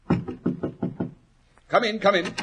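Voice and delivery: high, carrying call to the stairs. Acted. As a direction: call him in from across the room. Calling visitor up the stairs.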